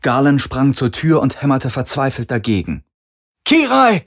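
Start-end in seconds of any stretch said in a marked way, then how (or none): 3.4-4.0 much higher, louder call; shouting. A narrator's reading. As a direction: call her name desperately. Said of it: "rief er".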